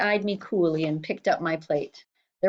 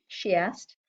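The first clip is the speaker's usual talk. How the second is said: ordinary delivery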